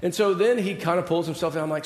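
normal talking voice

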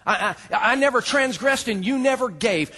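high, loud, stammered self-defence; indignant outburst. Acted. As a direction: blurt it as the offended son. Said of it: "You hear his heart".

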